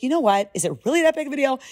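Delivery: high, fast defensive comeback. Retold or imitated. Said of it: "I'd be defensive".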